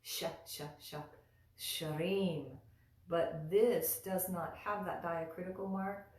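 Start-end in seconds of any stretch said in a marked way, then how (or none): 0.0-1.0 short separate "sh" syllables, hushing consonant; sh sound. Shown as a demonstration. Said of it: "makes it sound like". 1.6-2.5 "shrim" with a clear hushing sh onset; sh at the start. Shown as a demonstration. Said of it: "sound like sh".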